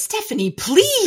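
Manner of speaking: pleading high and loud at the author; a dramatic groan of a plea; exasperated plea. Real, not acted.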